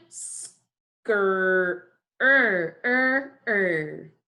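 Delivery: "Skr" stretched, then repeated long "er"; slow sounding out. Shown as a demonstration. Teacher asking class to hear a sound, slowly repeated. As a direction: isolate the r as a drawn-out "er". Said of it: "say it slowly again".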